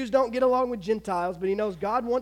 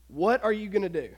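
normal talking voice

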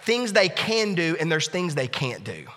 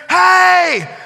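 yell; 11 semitones higher, 8 dB louder, slower than usual. Retold or imitated. Neighbor yelling from woods.

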